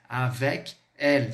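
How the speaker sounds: slow "avec ... elle", K cut off before "elle"; wrong split pronunciation. Shown as a demonstration. Teacher warning students about the K, wrong split pronunciation. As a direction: pause between "avec" and "elle". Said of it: "Você não vai dar esse K".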